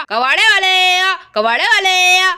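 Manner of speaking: defiant hawker's cry. Acted. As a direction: call out provocatively to spite the complainer. कबाड़ी जानबूझकर फिर चिल्लाता है. (चिढ़ाते हुए कबाड़ी की हाँक)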